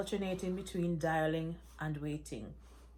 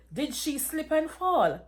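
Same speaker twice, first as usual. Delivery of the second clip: higher, louder urgent question; alarmed cry; 8 semitones higher, 7 dB louder than usual. Acted. Daughter cries out in alarm.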